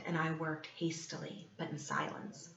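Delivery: fading to an unvoiced whisper. A narrator's reading. Killer confiding his silent work in a whisper. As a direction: breathe it out in secret.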